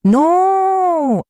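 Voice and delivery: high, loud anguished cry; broken scream. A narrator's reading. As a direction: let the scream crack apart. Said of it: "un grito roto". (un grito roto, agudo y fuerte)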